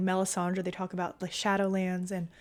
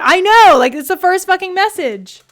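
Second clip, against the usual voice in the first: much higher and louder, real exclamation; excited outburst; 11 semitones higher, 15 dB louder than usual. Real, not acted. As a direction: burst out, delighted and loud. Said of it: "reacting to chat message".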